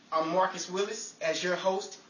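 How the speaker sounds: ordinary talk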